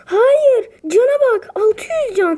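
high, loud crying protest; anguished wail. Real, not acted. Player protesting as the enemy heals, loud crying wail. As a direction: wail it out in real despair. Opponent gains health, player panics.

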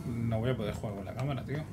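normal talking voice

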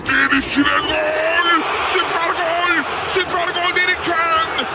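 excited goal call, rising; goal shout. Real, not acted. Goal call in live commentary. (heyecanlı gol çığlığı)

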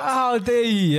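high-pitched scandalised teacher; mock outrage. Quran teacher scolding a cheeky pupil, mock outrage.

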